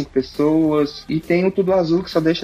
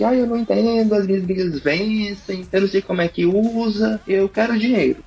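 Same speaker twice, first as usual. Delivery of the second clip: lightly voiced, higher confused client; confused customer voice; 4 semitones higher than usual. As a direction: imitate a lost, whiny customer. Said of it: "um público de pessoas que". (imitando cliente confuso)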